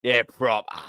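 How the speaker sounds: hammed-up East London Cockney; Cockney accent. Shown as a demonstration. Streamer showing viewers a proper East London sound, Cockney accent. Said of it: "the Cockney sort of sound of it".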